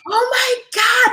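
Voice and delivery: stretched-out, high "Oh my God"; thrilled disbelief. Retold or imitated. Hearing she booked the commercial, squealing in slow delight.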